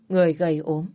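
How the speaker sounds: usual speaking voice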